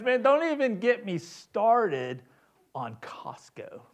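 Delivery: badly faked French accent, trailing down slowly. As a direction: quote him in rough French accent, sinking at the end. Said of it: "the French accent".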